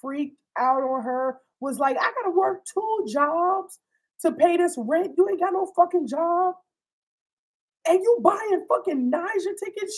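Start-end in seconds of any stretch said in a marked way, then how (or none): 2.0-10.0 mock outrage at a bad gift; imitating furious girlfriend. Rashida chewing out Dre over the tickets, higher mock fury. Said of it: "Rashida freaked the fuck out on her".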